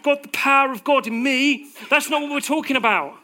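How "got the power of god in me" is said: loud, high mock-British proclamation; hammed-up British accent, loud and high. Shown as a demonstration. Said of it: "Let's do a very British accent".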